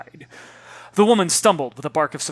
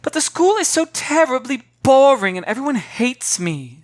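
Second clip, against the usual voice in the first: high, louder whiny complaint; 10 semitones higher, 7 dB louder than usual. Acted. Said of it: "she moaned".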